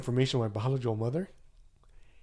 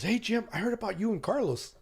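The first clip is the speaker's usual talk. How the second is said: high-pitched, eager approach; imitating a coworker, pitch raised; retold eager hail; 10 semitones higher than usual. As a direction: call over a coworker with news. Retold coworker calling out gossip.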